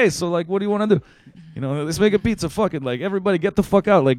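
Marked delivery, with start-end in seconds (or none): 0.0-1.0 high-pitched mock Italian invitation; Italian cliché. Host imitating the pizza maker saying drop by, Italian cliché. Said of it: "Italian kind of cliche". 1.8-4.0 high, louder mock Italian bossing; Italian cliché. Host imitating the pizza maker emptying his kitchen, Italian cliché. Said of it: "Italian kind of cliche".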